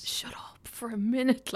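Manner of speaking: slow, whispered request for silence; acted whisper, drawn out; mock hushing. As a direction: whisper it slowly, as if shushing a partner. Acted hushing of a noisy partner.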